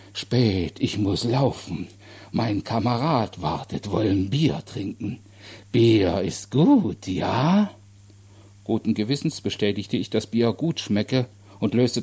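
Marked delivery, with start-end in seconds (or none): 0.1-7.7 slow, halting, stretched words; booming simple-minded man. Acted. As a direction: talk ponderously, ending on an eager question. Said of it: "sein dröhnender Bass". (schwerfällig und langsam)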